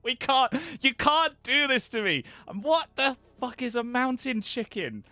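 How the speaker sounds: high, exasperated disbelief; can't believe what you're hearing; incredulous outburst. Real, not acted. Outraged at chat's joke.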